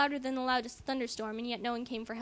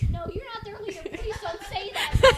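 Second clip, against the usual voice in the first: higher, giggling plea, slowed down; laughing protest; 7 semitones higher, 8 dB louder than usual. Real, not acted. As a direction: beg her to stop while cracking up. Laughing protest at mom reading.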